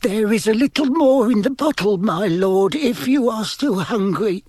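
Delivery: high-pitched, timid servant voice; timid, fearful servant. A narrator's reading. Servant offering the bottle to his lord, timid and fearful. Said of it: "It sounded timid and fearful".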